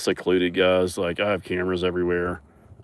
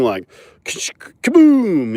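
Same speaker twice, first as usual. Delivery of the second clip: higher, louder, drawn-out kaboom; slow booming kaboom; 9 semitones higher, 8 dB louder, much slower than usual. Retold or imitated. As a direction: stretch the crash sound out. Tells of crash onto trailer.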